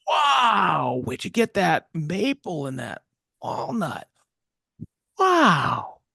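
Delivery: high, astonished wow at the lumber; amazed Rick. Retold or imitated. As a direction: gasp in wonder at the slabs. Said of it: "Rick had come by and he said".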